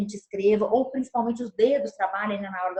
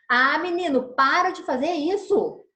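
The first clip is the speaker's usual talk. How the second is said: put-on louder, higher, slow telling-off of a boy; scolding; 5 semitones higher, 5 dB louder, slower than usual. Shown as a demonstration. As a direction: scold the kid firmly. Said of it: "Viram que eu mudei?".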